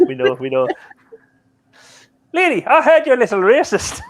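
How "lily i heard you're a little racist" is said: put-on Father Ted style Irish accent, pitched up; teasing mock Irish priest voice. Host teasing Lily in an Irish accent. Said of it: "Why did you do that in an Irish accent?".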